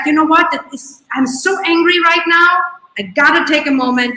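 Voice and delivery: owning anger. Retold or imitated. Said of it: "it's like".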